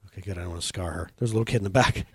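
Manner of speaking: ordinary talk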